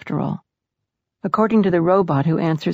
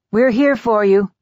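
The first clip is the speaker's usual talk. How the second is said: loud, higher call of support; raised voice, pitched up to carry; 4 semitones higher, 6 dB louder than usual. A narrator's reading. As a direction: project reassurance through a door. Brother calling through the door.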